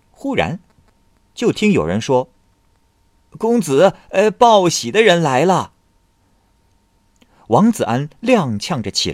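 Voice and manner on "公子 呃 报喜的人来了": high, loud herald calling out good news; eager servant shouting the news; excited announcement. Acted. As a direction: burst in crying the good tidings.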